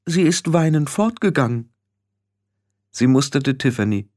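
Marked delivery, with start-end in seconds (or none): 0.1-1.7 measured, high-pitched scolding; prim reproach. A narrator's reading. Frau Grad reproaches Tiffany for being unfriendly to Petulia, prim high reproach. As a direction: voice the old witch formally lodging a complaint, pitched up.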